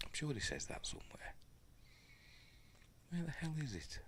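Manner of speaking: unvoiced, exasperated murmur; a whispered grumble while searching; frustrated whisper. Real, not acted. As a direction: whisper it in irritation under your breath.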